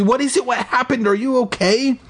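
high, urgent run of questions; breathless yell. A narrator's reading. Kid questioning his hurt friend, high breathless urgency. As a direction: keep it high and urgent throughout. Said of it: "I yelled breathlessly".

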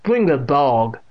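loud deaf woman's order; stern old aunt. A narrator's reading. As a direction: order it sternly, words thickened. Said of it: "she said in a low voice".

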